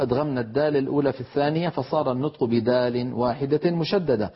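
ordinary talk

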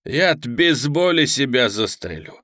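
slow, drawn-out gleeful taunt; jeering villain, gloating. Acted. Pudovochkin taunts his hostage about burning, gloating. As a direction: savour the threat, stretching the words.

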